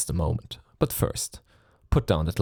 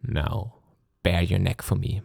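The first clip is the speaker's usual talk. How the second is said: normal talking voice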